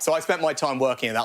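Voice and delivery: ordinary talk, nothing marked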